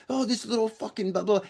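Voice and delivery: hammed-up muttered insults trailing into blah; imitated smack talk. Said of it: "started talking smack about me".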